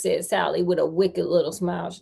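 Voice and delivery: usual speaking voice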